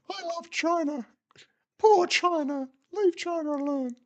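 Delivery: hammed-up high voice crying for China; mock pro-China whine.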